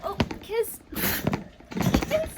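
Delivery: high, loud alarmed call. Real, not acted. Trainer calling out to Kismet as he falls off the bed, loud and high.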